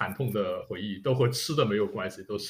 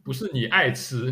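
ordinary delivery

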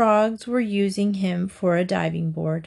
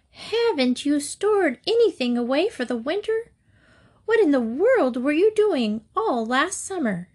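higher, incredulous ant voice; surprised ants; 6 semitones higher than usual. A narrator's reading. Ants questioning the lazy grasshopper, high incredulous voice. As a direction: scold in startled disbelief. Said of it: "cried the ants in surprise".

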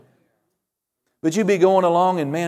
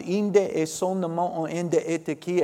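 softer, running stream of tongues; speaking in tongues; 5 dB quieter than usual. Real, not acted. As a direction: pray out unknown syllables in one unbroken flow. Speaks in tongues mid-sermon.

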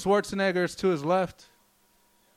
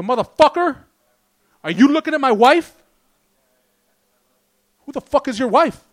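loud, higher tough-guy bark; aggressive gym challenge; 5 semitones higher, 8 dB louder than usual. Retold or imitated. Comic picks a fight with gym guys, loud mock aggression. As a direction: pick a fight with a roided-up stranger. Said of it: "Just go".

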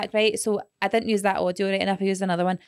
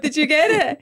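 ordinary talk

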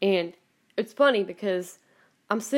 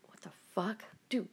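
unvoiced hushed swear; whispered disbelief; 9 dB quieter than usual. Reacting to Faithfully suddenly playing, whispering. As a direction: hiss it in disbelief. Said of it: "I'm like".

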